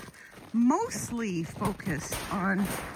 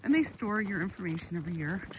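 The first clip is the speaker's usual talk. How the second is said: usual speaking voice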